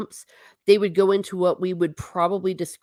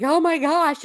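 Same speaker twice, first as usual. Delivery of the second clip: mock-shocked gasp, pitched high and louder; shocked reaction; 11 semitones higher, 4 dB louder than usual. Coworkers finding her crying in the lounge, mock shock. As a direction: imitate a coworker's startled, high-pitched concern. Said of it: "and they're like".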